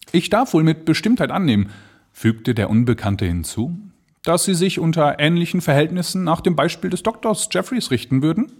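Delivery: höher und etwas schneller, lauernd; probing stranger. Acted. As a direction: fragt lauernd nach. The stranger asks the narrator. (der Unbekannte zum Erzähler, lauernd)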